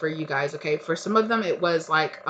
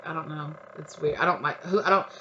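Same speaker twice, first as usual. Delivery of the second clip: ordinary delivery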